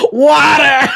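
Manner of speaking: high, loud shouted call; yelling an order across a counter; mock shout. Counter guys shouting the order out. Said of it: "both the guys behind the counter scream out".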